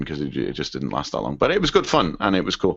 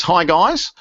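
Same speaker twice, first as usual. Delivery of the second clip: higher-pitched attempt at an Australian accent; Aussie accent; 11 semitones higher, 4 dB louder than usual. Podcast host reading a listener's farewell email, in an Aussie accent. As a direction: put on a light Aussie voice. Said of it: "read this in an Aussie accent".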